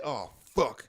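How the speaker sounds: normal talking voice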